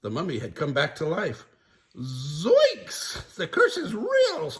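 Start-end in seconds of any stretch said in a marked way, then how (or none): 2.0-4.5 high, loud scared cry; Shaggy crying out. Acted. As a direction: panic at the curse. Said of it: "cried Shaggy".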